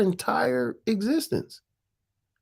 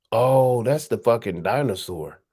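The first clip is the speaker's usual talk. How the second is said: ordinary delivery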